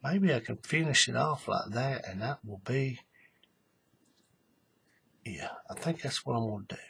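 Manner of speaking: very soft, low self-talk; thinking aloud. Real, not acted. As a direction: murmur to yourself while working it out. Thinking to himself while working.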